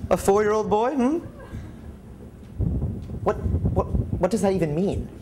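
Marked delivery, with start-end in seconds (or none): none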